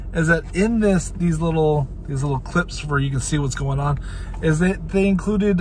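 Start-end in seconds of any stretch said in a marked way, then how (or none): none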